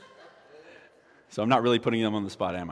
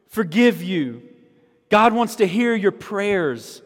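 louder, higher, climbing preacher's cry; full-force preaching; 8 semitones higher, 9 dB louder than usual. Acted. Pastor to congregation, impassioned preaching. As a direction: lift the room to a crescendo.

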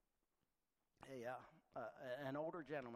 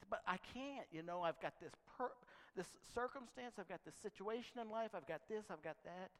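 higher, pleading excuses from a reluctant believer; whiny excuse-maker; 6 semitones higher than usual. Retold or imitated. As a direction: beg off like a reluctant churchgoer.

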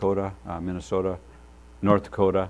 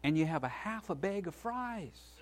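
lightly voiced Jesus; 8 semitones higher than usual. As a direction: speak as a gentle, disappointed Jesus. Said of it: "and he says".

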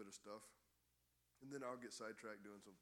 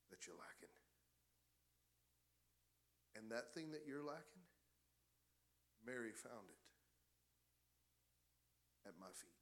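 gentle Jesus. Acted. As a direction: answer her gently, almost hushed. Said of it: "Jesus looks at Martha and he says".

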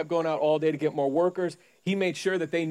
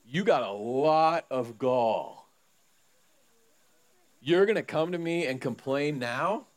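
stern master; 3 semitones lower, slower than usual. Retold or imitated. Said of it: "he's looking at them and he's going".

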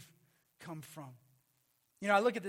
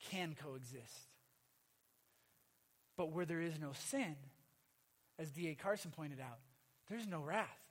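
ordinary talk, nothing marked

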